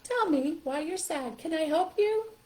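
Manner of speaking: high, slow sympathetic puppet; high, drawn-out concerned animal voice; Softy. Shown as a demonstration. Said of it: "here comes Softy. This is Softy".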